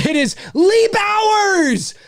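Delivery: high, stretched-out name announcement; excited announcing. Real, not acted. Host announcing a big donor to viewers, excited announcing.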